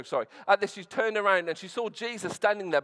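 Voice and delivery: normal talking voice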